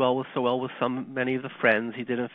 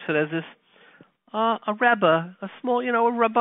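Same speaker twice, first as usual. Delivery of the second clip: ordinary delivery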